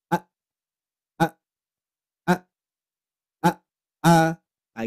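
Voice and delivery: each "A" started with a glottal catch and cut short; throat-stopped A. Shown as a demonstration. Teacher drilling the hamzah A for parents. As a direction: repeat "A" with a hard stop in the throat each time. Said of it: "cara prakteknya gini".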